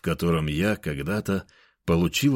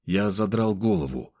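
ordinary delivery

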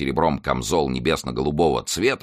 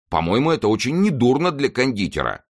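higher-pitched, insistent self-defence; protesting exclamation; 8 semitones higher, 3 dB louder than usual. A narrator's reading. Confectioner defends his riding to his master, exclaiming. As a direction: stand up for yourself, voice raised in pitch. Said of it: "воскликнул Планше".

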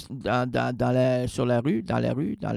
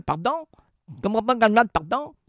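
mock French listener; 6 semitones higher, faster than usual. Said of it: "vous ne comprenez pas quand tu parles".

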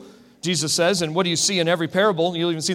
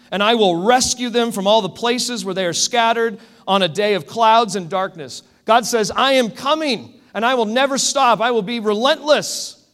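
raised, emphatic scripture proclamation; forceful preaching; 3 semitones higher, 5 dB louder than usual. Acted. Preacher voicing God's promise to search for his sheep, forceful proclamation. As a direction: proclaim God's promise with conviction, louder and higher toward the end.

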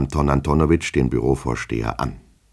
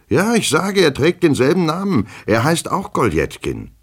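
very high, louder outburst; worked-up, high-pitched exclamation; agitated insistence; 13 semitones higher, 4 dB louder than usual. Acted. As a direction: hammer it home in a high voice. Agitated clerk insists on shared name.

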